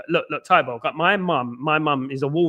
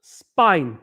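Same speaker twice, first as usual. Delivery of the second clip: "Spain" in broad Cockney, falling at the end; playing up the Cockney vowel in "Spain"; Cockney accent; 4 dB louder, much slower than usual. Shown as a demonstration. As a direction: say "Spain" like a Cockney, one word at a time. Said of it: "can't say Spain without a Cockney accent".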